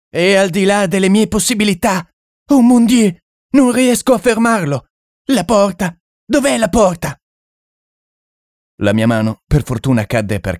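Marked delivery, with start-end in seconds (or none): 0.1-7.1 high, frantic cries; a terrified man yelling for the door; panicked shouting. Acted. The Frenchman in the dark yells for the door, panicked.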